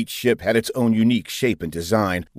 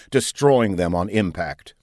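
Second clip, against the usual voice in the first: ordinary delivery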